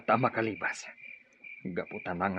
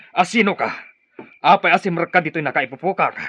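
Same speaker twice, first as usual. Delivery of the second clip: high, much louder alarmed yelling; frightened shout; 7 semitones higher, 11 dB louder than usual. Acted. Prisoner confronting a stranger in his cell, frightened shout.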